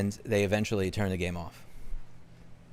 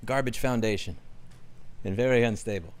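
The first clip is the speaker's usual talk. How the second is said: usual speaking voice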